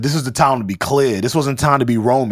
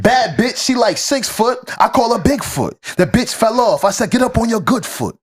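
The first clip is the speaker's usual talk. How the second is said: higher, twitchy addict rap voice; crackhead voice; 6 semitones higher than usual. Shown as a demonstration. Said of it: "I'm trying to read and crackhead, uh, tone is hard".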